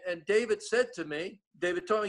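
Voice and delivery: ordinary delivery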